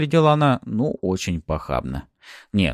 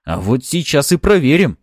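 loud, raised, angry challenge; growled threat; 7 semitones higher, 6 dB louder than usual. A narrator's reading. Fyodor pulls his weapon on Boyarsky, growled threat. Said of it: "рыкнул он".

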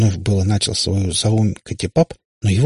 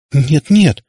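high, louder distressed refusal; almost crying; 7 semitones higher, 7 dB louder than usual. A narrator's reading. Katya rejects the merging idea, almost in tears. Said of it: "чуть не плача проговорила она".

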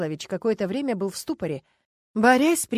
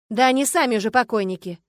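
louder, brisk counter-argument; objecting; 3 semitones higher, 8 dB louder than usual. A narrator's reading. District officer countering the investigator about relatives, objecting. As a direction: push back on the point. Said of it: "возразил участковый".

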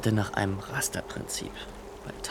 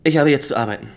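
curt dismissal; 5 semitones higher, 9 dB louder, faster than usual. Acted. Cutting the visit short.

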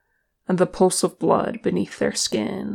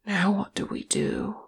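soft, hushed question; subdued and small; 2 semitones higher, 5 dB quieter than usual. A narrator's reading. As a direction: ask it quietly, at a loss. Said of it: "said Ori in a hushed voice".

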